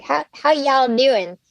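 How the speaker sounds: put-on Southern accent; mock Southern accent. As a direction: impersonate a Southerner, drawing it out. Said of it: "when people impersonate Southerners".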